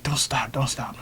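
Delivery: soft, hushed pleading; urgent whisper. Retold or imitated. Woman to her lover in bed, imitated whisper.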